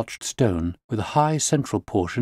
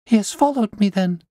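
high, louder anxious question; fearful question; 12 semitones higher, 4 dB louder than usual. A narrator's reading. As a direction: ask it dreading the answer. Frightened client after bad news.

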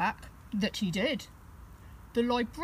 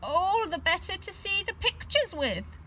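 high put-on granny voice; the wolf hiding behind a high sweet granny voice; high fake granny; wolf as Grandma; 10 semitones higher than usual. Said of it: "said the wolf".

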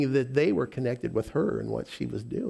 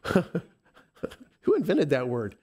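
laughing; much slower than usual. Real, not acted. Laughing at own lisp.

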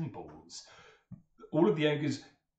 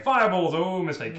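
shouting fireballs; 8 semitones higher, 10 dB louder than usual. Acted. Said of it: "started going".

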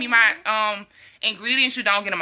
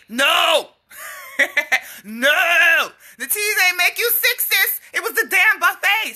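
exasperated outburst; 8 semitones higher, slower than usual. Real, not acted. Mocking customer blaming the teas.

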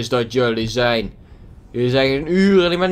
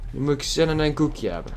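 usual speaking voice

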